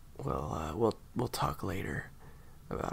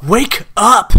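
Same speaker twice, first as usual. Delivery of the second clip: full-volume shout, much higher; desperate shout; 12 semitones higher, 20 dB louder than usual. Acted. Partner screaming to wake the listener, desperate shout. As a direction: yell them out of the nightmare.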